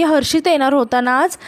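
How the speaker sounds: ordinary delivery, nothing marked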